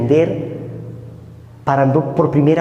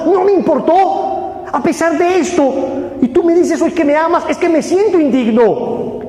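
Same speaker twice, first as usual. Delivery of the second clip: anguished confession; 11 semitones higher than usual. Acted. Sinner confessing to God, building to an anguished cry. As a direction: let the guilt swell into a near shout.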